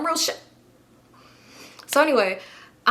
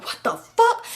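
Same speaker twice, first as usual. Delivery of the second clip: high shocked what the fuck; 13 semitones higher, slower than usual. Retold or imitated. Retelling the block, high stunned disbelief. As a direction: pitch leaps up in outrage.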